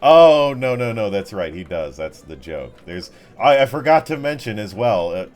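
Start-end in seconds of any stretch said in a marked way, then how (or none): none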